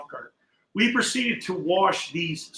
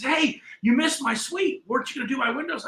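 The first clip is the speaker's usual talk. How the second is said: lightly voiced shop owner; 8 semitones higher than usual. Shop owner tells the window washer he was skipped, a touch complaining. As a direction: play the put-out shop owner, higher.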